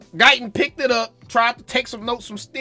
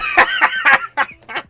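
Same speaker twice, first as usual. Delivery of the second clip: squealing burst of laughter; high laugh; 3 dB louder than usual. Real, not acted. Fan laughing at his own wailing, high squealing laugh. Laughs after mock wail.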